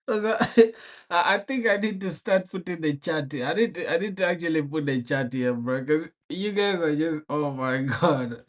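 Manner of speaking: words breaking into laughter; can't stop laughing mid-sentence; laughing while talking. Real, not acted. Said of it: "I can't stop laughing".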